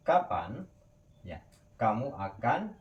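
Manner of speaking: normal talking voice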